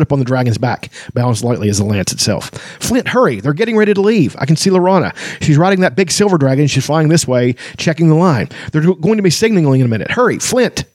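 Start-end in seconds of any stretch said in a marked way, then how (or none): 2.8-10.8 high, eager, hurried; excited kender. Acted. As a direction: hurry your friend, bouncing with excitement. Taz urging Flint.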